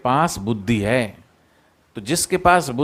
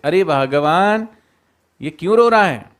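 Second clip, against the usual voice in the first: loud fake alarm; 5 semitones higher, 4 dB louder than usual. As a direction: call it out loud so the lion hears. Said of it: "सियार ने पूछा सियारनी से".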